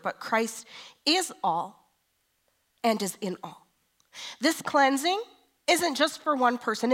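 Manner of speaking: loud, high fervent preaching; full-force preaching. Acted. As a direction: preach it out to the whole church.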